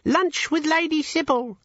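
very high, slow squeaky imp; tiny creature voice, drawn out. Acted. Imp continues.

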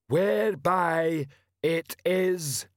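troll sergeant announcing to the crowd; slow troll. A narrator's reading. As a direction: spell it out slowly like a dim troll.

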